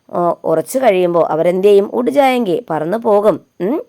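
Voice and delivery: ordinary talk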